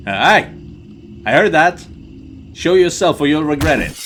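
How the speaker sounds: loud, high threatening yell; angry shout. Acted. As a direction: bark a warning at the shadows. Confronting a noise, threatening.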